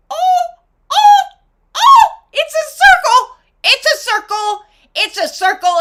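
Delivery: high, loud gasp of discovery; sudden revelation. Real, not acted. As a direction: burst out with the aha moment.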